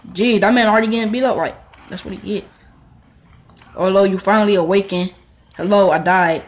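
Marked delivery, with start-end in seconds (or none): none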